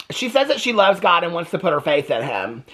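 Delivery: ordinary talk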